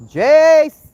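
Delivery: loud high call across the yard. Real, not acted. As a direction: shout his name to fetch him. Mother calling son outside.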